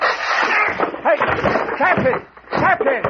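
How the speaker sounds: high, loud frantic calls down the cellar; alarmed shout. Acted. Mayor shouting down to the fallen captain, alarmed. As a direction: yell down the hole for him.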